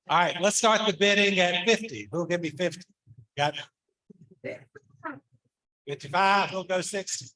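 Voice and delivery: brisk auction bid calling; auctioneer. Acted. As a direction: call the bids like an auctioneer. Calls bids at auction.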